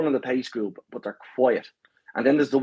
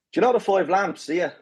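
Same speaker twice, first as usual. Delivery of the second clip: mock-innocent set-up; 6 semitones higher than usual. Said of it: "you know you're getting set up".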